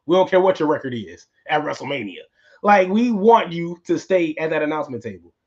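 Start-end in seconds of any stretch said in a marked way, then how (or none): none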